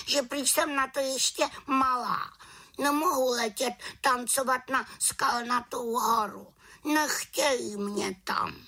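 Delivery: very high, small witch voice; aggrieved little witch. A narrator's reading. Little witch says the big ones exclude her, aggrieved high voice. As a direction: complain like the one left out.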